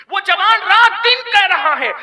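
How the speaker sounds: high, loud, slow preaching cry; soaring slow exclamation; impassioned cry. Acted. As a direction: cry it out to the crowd.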